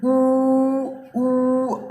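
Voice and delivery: long, high, steady "ऊ"; demonstrating vowel sound. Shown as a demonstration. Teacher shows where the sound comes from, demonstrating "ऊ". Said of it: "आवाज़ यहाँ से निकल रही है ना".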